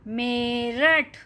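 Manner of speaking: Meerut drawn out syllable by syllable, me-rath; slow clear reading. Shown as a demonstration. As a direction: spell out Meerut slowly for children to repeat. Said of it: "repeat करते रहिएगा".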